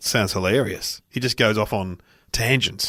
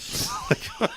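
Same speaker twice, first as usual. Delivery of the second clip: breathy laugh; 6 dB quieter than usual. Real, not acted. Laughing at lol story.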